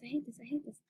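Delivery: breathless scared whisper. Real, not acted. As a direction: whisper it in fear. Unvoiced scared repeat.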